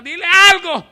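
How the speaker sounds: loud, high, exasperated demand; fed-up follower demanding action. Retold or imitated. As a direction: burst out, sick of the noise. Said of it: "los discípulos ya estaban hartos".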